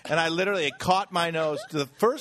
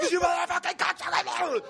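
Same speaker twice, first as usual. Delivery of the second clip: high-pitched mock rage; furious yell. Trader exploding into a fight, high mock rage. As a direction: scream the insult in hammed-up fury. Fight erupts in retold scene.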